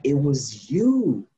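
slowly, as if it just dawned on him; slow accusation. Retold or imitated. To the man who broke into his car, slow dawning accusation. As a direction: drag it out, pointing the finger. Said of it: "I was like".

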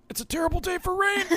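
very high, strained mock crying voice; mock tearful. Quoting a sad line to co-hosts after a moving scene, mock tearful. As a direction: squeak it out as if choking up.

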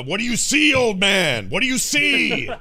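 high, loud demanding yell; mock yell. Player yells at the captive old man, high mock shouting. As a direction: yell the question in playful interrogation. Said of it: "then I yell at him".

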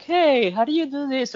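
lightly put-on curious friend; imitated questioner. Husband quotes friends asking him for answers, high-pitched imitation.

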